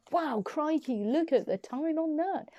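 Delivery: high, excited mock gasp at the time; amazed. Vlogger retelling her amazement at the fast upload, high and excited. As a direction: gush like you can't believe the speed.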